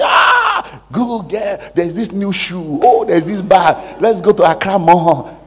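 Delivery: a high squeal that settles into eager chatter; mock excited girlfriend. The girlfriend on the phone, squealing about shoes and bags. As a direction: act the friend who squeals at the chance to spend.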